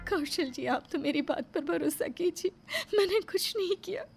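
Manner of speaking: soft, crying plea; tearful pleading. Acted. Wife begging her husband to trust her, tearful. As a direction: beg him softly through tears.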